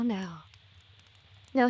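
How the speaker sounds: ordinary talk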